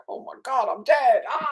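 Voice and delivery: squeaky high, panicked cry; mock panic. Mocking divers who panic, hammed-up panicked yelp. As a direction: ham up a diver losing it underwater. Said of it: "not panic and say".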